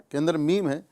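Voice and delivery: ordinary talk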